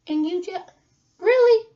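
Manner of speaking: mock disbelief at the jealous friend; incredulous really. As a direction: pitch leaps up, loud on really. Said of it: "And I'm sitting there like".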